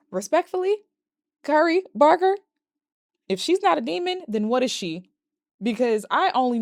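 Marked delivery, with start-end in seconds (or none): 0.1-5.1 high-pitched, pointedly polite challenge; respectful objection. Retold or imitated. Viewer disputing the writer's claim, high mock-polite objection. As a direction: take it up high, pausing between phrases.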